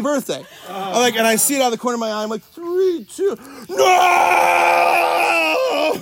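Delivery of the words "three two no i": high, loud cry of alarm; yelling to stop it all; panicked shout. Retold or imitated. Birthday class walks in mid-countdown.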